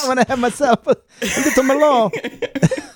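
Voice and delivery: high, laughing mock Italian accent. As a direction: laugh through a hammy Italian voice. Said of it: "like, an Italian accent".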